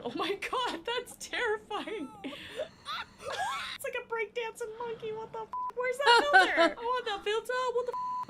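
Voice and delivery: high, squealing laughter through the words; real helpless laughing, pitch shooting up; laughing in shock. Real, not acted. Reacting to a terrifying monkey filter, laughing in shock.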